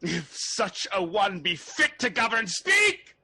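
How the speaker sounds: high, loud, demanding shout; shouted challenge. Acted. Malcolm daring Macduff to judge him, shouted. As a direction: fling the question at him.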